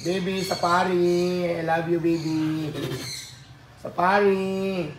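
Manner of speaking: soft, slow cooing at a pet. Real, not acted. Cooing baby talk to animal.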